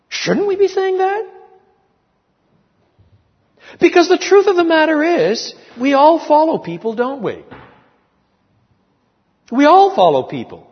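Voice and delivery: high, loud, insistent preacher; impassioned preaching. Real, not acted. Preacher challenging the congregation to say "imitate me", impassioned and loud. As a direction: press the challenge on them at full voice.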